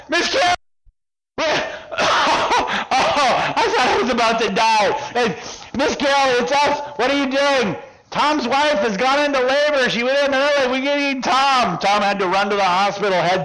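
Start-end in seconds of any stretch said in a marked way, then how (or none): 0.1-4.9 high, gasping cry of relief; overwhelmed relief. Retold or imitated. Hiding man recognises Miss Carol, hugely relieved. 5.8-7.7 high, excited call; relieved calling out. Retold or imitated. Hidden men call to Miss Carol, relieved. 8.1-11.8 high, pressing announcement; urgent news. Retold or imitated. Miss Carol fetching Tom for his wife's labor, urgent.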